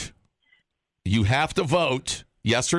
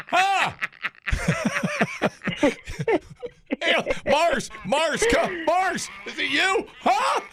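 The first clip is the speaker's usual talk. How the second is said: very high shouting alien; slower than usual. Acted. Host calls out alien-style.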